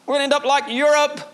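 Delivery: high, loud mock doom-saying; pessimistic moaning. Mimicking pessimistic believers about the church, high mock wail. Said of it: "with a pessimistic attitude".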